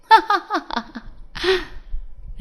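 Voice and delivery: high gloating villain laugh; wicked high-pitched laughter; villainous laugh. A narrator's reading. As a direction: laugh in triumph over her rival. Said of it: "Ruby हंसते हुए बोलती है।".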